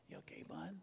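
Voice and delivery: hushed little question; gentle hushed aside. Real, not acted. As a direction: say it tenderly, almost under your breath. Checks gently on the baby.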